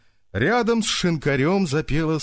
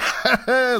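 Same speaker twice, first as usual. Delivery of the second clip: short burst of laughter; Monya's laugh; 11 semitones higher than usual. Acted. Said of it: "засмеялся Моня". (смех Мони)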